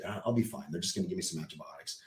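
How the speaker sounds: low, soft, casual brush-off. Retold or imitated. Husband fibbing to his worried wife, offhand reassurance. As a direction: play it down quietly and quickly.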